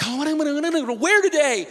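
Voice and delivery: high, frantic fretting squeak; mock panic. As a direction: play a flower fussing in a hammed-up panic. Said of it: "Lilies aren't freaking out".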